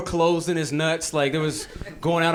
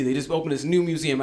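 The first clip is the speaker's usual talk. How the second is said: usual speaking voice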